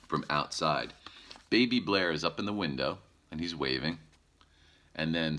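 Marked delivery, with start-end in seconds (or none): none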